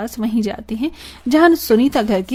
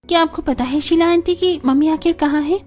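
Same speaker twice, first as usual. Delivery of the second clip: higher-pitched daughter asking anxiously; questioning daughter; 6 semitones higher than usual. Acted. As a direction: ask as a worried daughter, voice pitched up. Daughters ask after their mother. (बेटी की ऊँची, चिंतित आवाज़)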